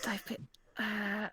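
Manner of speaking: hushed, hesitant key phrase; muttering under breath. Shown as a demonstration. Actor searching for a Birmingham accent, muttering under breath. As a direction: try it out softly to yourself. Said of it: "keep whispering to myself under my breath".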